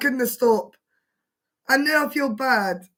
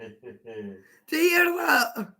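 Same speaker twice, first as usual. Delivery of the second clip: 1 croaky; 2 semitones lower, much slower than usual. Real, not acted. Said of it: "I've lost my voice".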